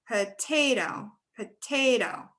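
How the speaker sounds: "potato" slowly, with the middle syllable TA leaned on; stress on TA. Shown as a demonstration. Teacher showing the stressed syllable in "potato", slowly. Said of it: "find that stressed syllable".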